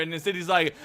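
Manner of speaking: normal talking voice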